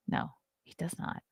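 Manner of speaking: unvoiced under-breath whisper; whispered aside. Real, not acted. Answering her own question in a whisper. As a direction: mutter it under your breath.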